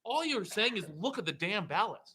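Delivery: high-pitched, exasperated rant; indignant and worked up; pissed off. Real, not acted. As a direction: let the frustration push the pitch up. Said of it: "That pisses me off".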